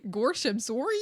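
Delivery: lightly voiced goofy cartoon oaf; rounded vowels, hint of a bumbling cartoon dog; playful mock apology. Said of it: "like they're goofy".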